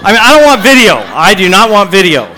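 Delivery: high, loud comic objection; mock protest. Preacher joking about wanting no video, mock-horrified shout. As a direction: cry out in hammed-up refusal.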